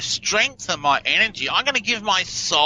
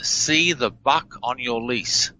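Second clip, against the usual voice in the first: slow reversal read-out; 3 semitones lower, slower than usual. Shown as a demonstration. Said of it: "And, uh".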